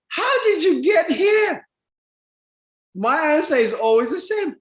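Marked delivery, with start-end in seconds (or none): 0.1-1.7 high, drawn-out mock shout; exclaimed question. People keep asking him this, mock incredulous shout. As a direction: repeat the question loudly in mock disbelief.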